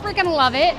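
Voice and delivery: usual speaking voice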